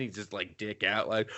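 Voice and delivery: usual speaking voice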